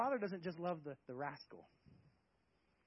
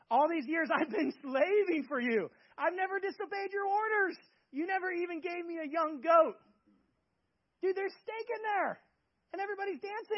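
raised, aggrieved protest at the father; indignant elder son, voice raised and higher; resentful complaint; 11 semitones higher, 8 dB louder than usual. Acted. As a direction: complain loudly that you were never given anything. Older brother's resentful complaint to father.

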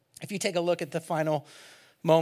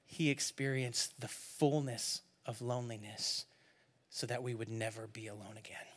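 ordinary talk

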